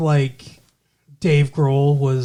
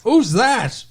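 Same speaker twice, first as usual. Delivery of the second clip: put-on New England accent; 8 semitones higher, 4 dB louder than usual. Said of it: "You sound like Jean-Luc Picard".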